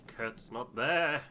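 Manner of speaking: a sudden surprised cry. Real, not acted. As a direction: catch it with a jolt. Surprised at empty cabin.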